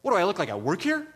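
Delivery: imitating a rude New Yorker; snappy retort. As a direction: bite back like an annoyed local. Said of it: "she's like".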